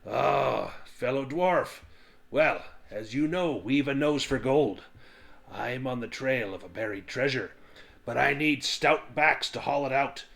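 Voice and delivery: rally the tavern like a gruff showman; boisterous dwarf recruiter. Acted.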